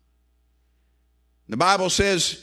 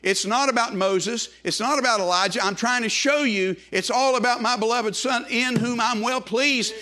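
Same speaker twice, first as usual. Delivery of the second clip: normal talking voice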